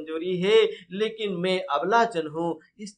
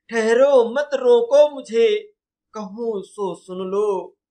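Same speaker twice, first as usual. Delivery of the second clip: loud, high, slow verse declamation; Kaikeyi; 6 semitones higher, 7 dB louder, slower than usual. Acted. Teacher voicing Kaikeyi asking the court to hear her, declaimed slowly. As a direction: declaim the queen's plea, stretching each phrase. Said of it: "Kaikeyi क्या कहती हैं".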